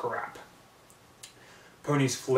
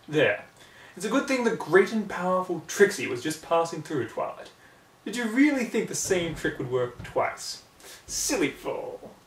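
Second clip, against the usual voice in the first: higher, smug showman voice; Trixie condescending; 6 semitones higher than usual. Acted. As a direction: look down on the fallen pony. Trixie talks down to Twilight.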